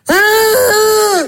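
high laughing yelp; laughing shriek. Retold or imitated. Pupil shrieking with laughter at the mistake.

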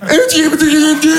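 high, fast playground retort; childish comeback. Retold or imitated. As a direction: throw it back fast and high like a kid. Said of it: "le seul truc que je peux répondre". (riposte enfantine, aiguë et rapide)

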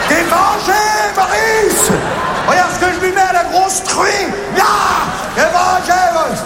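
appel hurlé, plus aigu et plus fort; cri de triomphe. Acted. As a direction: hurle au copain mort, triomphant. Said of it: "il dit". (il hurle sa victoire à son pote Maurice)

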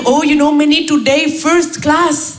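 put-on slow, stretched Indian-accented English; singsong Indian accent, savouring each word; Raj. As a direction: imitate a blissful colleague in an Indian accent. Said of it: "il arrive".

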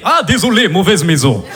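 put-on Russian accent. Russian gangster at the wrong house, Russian accent. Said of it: "Je fais bien l'accent".

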